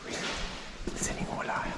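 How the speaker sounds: hushed whisper for a quiet church; barely audible, voiceless murmur; real whisper. Real, not acted. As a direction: whisper softly so as not to disturb. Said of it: "whispering because, uh, you've gotta be very quiet".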